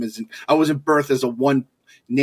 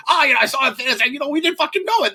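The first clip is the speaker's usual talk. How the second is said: high, hammed-up Boston accent impression; awful Boston accent; 12 semitones higher, faster than usual. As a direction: imitate an old Boston guy telling a story, badly. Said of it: "that was an awful Boston accent".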